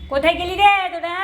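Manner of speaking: loud, high call across the house. Real, not acted. Mother calls out for kids.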